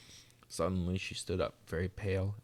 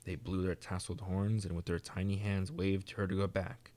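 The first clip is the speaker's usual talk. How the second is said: usual speaking voice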